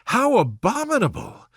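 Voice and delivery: put-on high, indignant young lady's outcry; Elizabeth crying out. A narrator's reading. Elizabeth to Wickham, indignant outcry. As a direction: burst out in outrage. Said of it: "cried Elizabeth".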